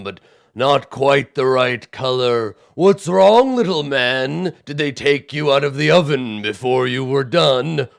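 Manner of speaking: hammed-up giant mockery; mocking giant. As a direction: taunt a tiny man like a bully giant. Cyclops voice.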